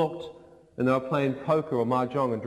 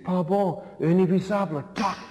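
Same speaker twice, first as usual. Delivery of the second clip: put-on French-accented friend; 6 semitones higher than usual. [name] naming the jellyfish.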